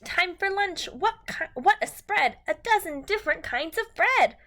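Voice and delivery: bright, sing-song picture book reading; reading to children. Acted. Teacher reading a rhyming book to her class, sing-song. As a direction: read the rhyme to a class, lilting and lively.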